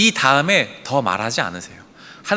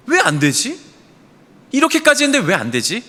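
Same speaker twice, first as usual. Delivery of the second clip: higher, exasperated self-questioning; frustrated bafflement; 7 semitones higher than usual. Retold or imitated. Leader whose methods failed, baffled and higher. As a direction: ask yourself in retold disbelief, pitched up.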